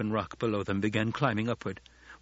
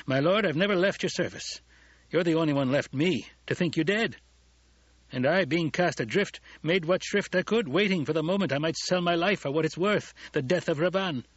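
higher-pitched, impassioned character voice; loyal retainer protesting; 7 semitones higher than usual. A narrator's reading. Gurney tells his lord he never left, impassioned protest.

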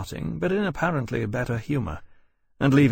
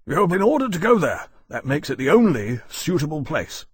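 higher, louder sharp rebuke; 5 semitones higher, 5 dB louder than usual. A narrator's reading. Commander rebuking Lawrence for asking about Scotland, sharp. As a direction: cut off the question sharply. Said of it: "Bowden said sharply".

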